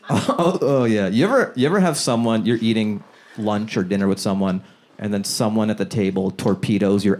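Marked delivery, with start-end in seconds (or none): none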